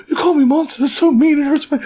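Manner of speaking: very high, pouty hurt voice; a little creature whose feelings are hurt; wounded cute creature. Retold or imitated. The reindeer whining at Usopp for calling him a monster.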